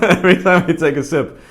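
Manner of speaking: words spoken through real laughter; laughing. Real, not acted. Laughing at the cup noise.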